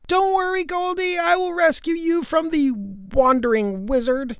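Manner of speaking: very high-pitched promise of rescue; heroic call. Acted. Girl to her kidnapped pet, high heroic call.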